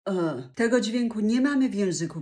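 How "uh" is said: E as a dull, lowered "uh"; dull low vowel. Shown as a demonstration. Teacher demonstrates a sound absent from Polish, dull "uh" for E. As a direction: let E fall into a hollow "uh". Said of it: "tworząc głuchy dźwięk".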